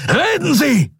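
high, forceful bellow; roared order. A narrator's reading. Admiral ordering captive to talk, roaring. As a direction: roar it at the captive. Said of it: "brüllte Thelios".

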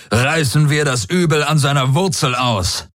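high, rousing battle cry; calling out. A narrator's reading. Field commander rallying his warriors, calling out. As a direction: spur the troops to attack. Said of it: "rief Daraios seinen Kriegern zu".

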